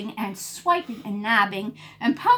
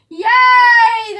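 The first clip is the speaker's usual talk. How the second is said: high, loud cheering yay; 13 semitones higher, 16 dB louder than usual. Acted. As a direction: cheer for the rescue. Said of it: "the crowd cheered".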